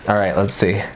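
ordinary delivery

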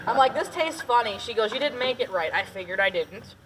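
ordinary talk

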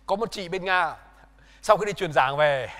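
normal talking voice

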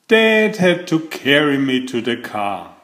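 ordinary delivery

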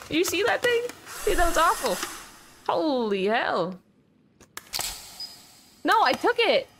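high frightened yelling; panicked shout. Real, not acted. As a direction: shriek it out in panic. Monster jump scare.

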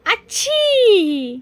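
high, slow mock sneeze aachhi; imitated sneeze. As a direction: act out big brother's sneeze for the baby. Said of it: "कसा करतो?".

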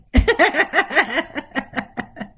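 rising, amused burst of laughter; tickled laugh at a friend; bright laugh. Real, not acted. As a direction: laugh along at the joke. Laughs at Yoli's nap comment.

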